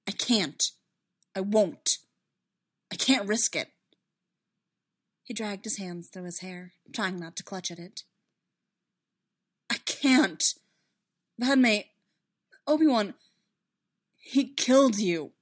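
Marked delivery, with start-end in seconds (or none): 0.1-3.7 desperate. Acted. Anakin desperate refusal. 9.7-15.3 anguished cry. Acted. Anakin anguished outburst.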